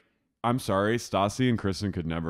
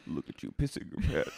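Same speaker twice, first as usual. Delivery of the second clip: hushed mock-villain taunt; Katie Maloney; 3 semitones higher, 5 dB quieter than usual. Katie mocking Schwartz's accident, hushed taunt. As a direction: softly sneer it in a put-on voice. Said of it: "she goes".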